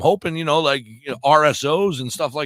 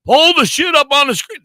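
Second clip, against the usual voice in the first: yelled much higher and louder at the crew; angry shout; 12 semitones higher, 10 dB louder than usual. Real, not acted. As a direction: bark it across the room, fed up. Yelling at crew to show screen.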